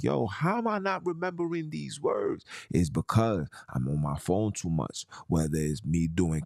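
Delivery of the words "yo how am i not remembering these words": pitched up high, baffled at himself; a high, bewildered aside to his wife; puzzled self-quote. Retold or imitated. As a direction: ask it like you just lost the word. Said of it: "I be like".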